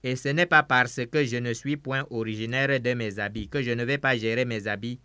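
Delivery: normal talking voice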